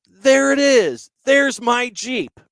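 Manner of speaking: high, loud, drawn-out call across the dock; retold yell. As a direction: belt it out like a colonel spotting his Jeep. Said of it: "he just yells out".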